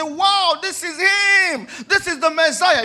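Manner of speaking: high, loud awestruck wow, slowing on him; awed exclamation. Retold or imitated. Preacher voicing the world seeing the Messiah, awed and loud. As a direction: cry out in wonder as if seeing him.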